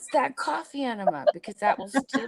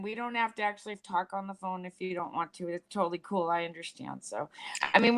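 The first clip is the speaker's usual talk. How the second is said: ordinary talk, nothing marked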